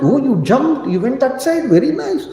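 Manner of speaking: high, pleased mother's voice; mother praising Hanuman's jump. Retold or imitated. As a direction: coo back approval. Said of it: "mother answers Hanuman".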